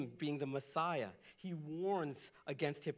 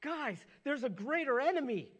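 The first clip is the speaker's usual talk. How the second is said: higher and louder, pleading with the guys; urgent appeal; 8 semitones higher, 6 dB louder than usual. Retold or imitated. Pastor voicing Jesus warning the crowd, loud and urgent. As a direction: press the warning hard, almost shouting. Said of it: "He's pointing out to them".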